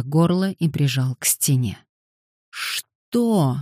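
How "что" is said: high, strained question; wheezed out. A narrator's reading. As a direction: force the word out, pitch up. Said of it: "снова прохрипел он". (с хрипом, выше обычного)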